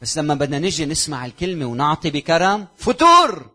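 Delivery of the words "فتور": loud exclamation. Acted. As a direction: call the word out loud and high. Preacher repeats word for emphasis. (صيحة عالية بكلمة فتور)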